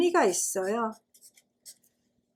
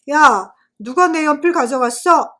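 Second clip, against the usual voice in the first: loud, higher-pitched classroom yell; booming voice; 4 semitones higher, 10 dB louder than usual. A narrator's reading. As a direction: yell it loud enough to blow the classroom away. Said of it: "교실이 날아갈 것 같은 목소리로".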